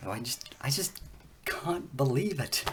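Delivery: breathless whisper, slowing down; hushed disbelief, barely voiced; whispering in real amazement; awed whisper. Real, not acted. Overwhelmed by DNA result.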